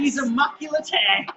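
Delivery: excited shout. Real, not acted. Excited outburst at the match.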